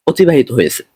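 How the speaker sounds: usual speaking voice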